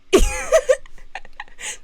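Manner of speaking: high giggling laugh; real laugh. Real, not acted. Streamer laughing at own camera joke, real laugh.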